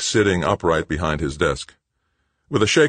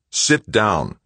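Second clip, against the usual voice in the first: sharp, louder command to sit; snapped order; 7 semitones higher, 5 dB louder than usual. A narrator's reading. Director snapping at his half-brother, sharp command. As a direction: snap it at your brother. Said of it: "Greg snapped".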